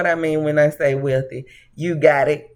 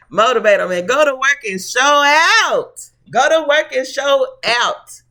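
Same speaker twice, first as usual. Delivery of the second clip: laughing while urging, a bit higher; 8 semitones higher, 4 dB louder than usual. Real, not acted. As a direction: hype them up through a laugh. Laughing hype advice.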